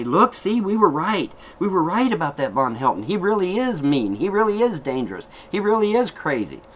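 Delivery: mock gloating. Haters gloating to strangers.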